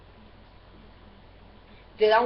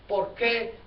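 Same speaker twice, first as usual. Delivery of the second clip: ordinary talk, nothing marked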